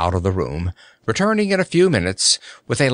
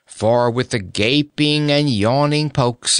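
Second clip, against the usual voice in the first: ordinary delivery, nothing marked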